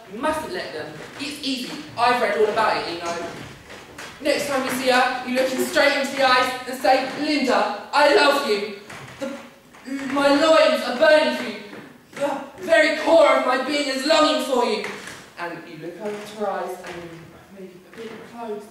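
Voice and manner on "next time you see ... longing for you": loud, theatrical romantic declaration; declaiming love. Shown as a demonstration. Boy coaching his mate to woo Linda, declaiming. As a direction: demonstrate a grand love speech with full force.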